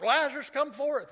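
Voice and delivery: high loud call to the tomb; loud calling command; group shouting. Retold or imitated. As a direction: shout it out to raise the dead. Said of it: "Loud, the whole group".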